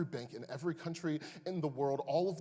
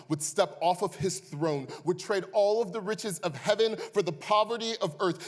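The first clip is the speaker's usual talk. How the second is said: rising, louder passionate preacher, held high; preaching at full force; 7 semitones higher, 10 dB louder, slower than usual. Acted. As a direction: build the sermon to a soaring climax. Preacher building to climax.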